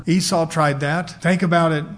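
normal talking voice

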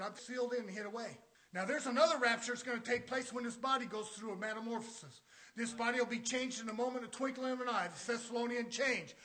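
usual speaking voice

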